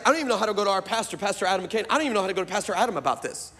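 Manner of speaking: fast, exasperated rush; flustered rush. Retold or imitated. Wife frets about telling their pastor, fast and flustered. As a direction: tumble out the words like the wife in a panic. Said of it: "she's like".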